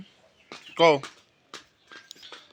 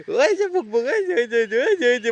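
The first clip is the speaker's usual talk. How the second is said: excited pointing; 6 semitones higher, 3 dB louder than usual. Real, not acted. Pointing out dog to child.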